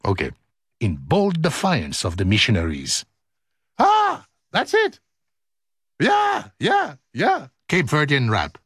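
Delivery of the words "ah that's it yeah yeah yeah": high excited cheer. Real, not acted. Excited about music.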